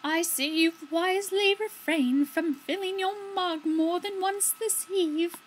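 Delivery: lilting host voice pitched high; character voice. Acted.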